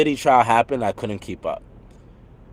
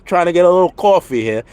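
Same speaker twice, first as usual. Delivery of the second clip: louder, higher mock Brooklyn Italian; Brooklyn Italian accent; 6 semitones higher, 5 dB louder than usual. Streamer mocks how he supposedly sounds, hammed-up Brooklyn Italian accent. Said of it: "a Brooklyn Italian".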